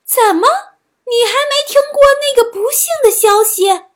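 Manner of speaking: high, loud mock surprise; feigned astonishment. A narrator's reading. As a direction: act shocked as a scheming fox. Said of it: "狐狸装作吃惊的模样说". (装作吃惊，高声地说)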